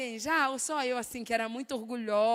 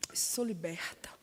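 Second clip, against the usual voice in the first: mock whisper; 4 semitones lower, 5 dB quieter, slower than usual.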